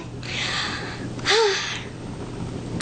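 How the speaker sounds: long soft airy sigh; a quiet unvoiced sigh before admitting nerves; nervous sigh. Real, not acted. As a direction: let out a shaky breath of nerves.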